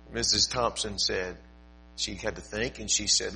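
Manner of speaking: ordinary talk, nothing marked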